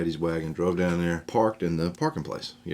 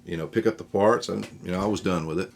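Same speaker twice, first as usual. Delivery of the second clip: ordinary delivery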